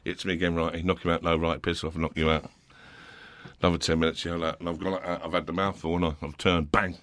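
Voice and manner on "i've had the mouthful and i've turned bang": ordinary talk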